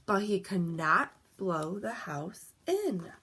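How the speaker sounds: plain reading voice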